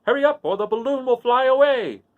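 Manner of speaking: the wizard calling Dorothy to hurry to the balloon; crying out. A narrator's reading. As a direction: call her over the crowd. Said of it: "cried the wizard".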